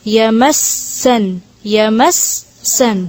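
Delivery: یمسن with the س held, once still and once with the vowel; یمسن with a doubled, held س. Shown as a demonstration. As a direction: stretch the س of یمسن very slowly. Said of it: "دو، صرف دو دفعہ".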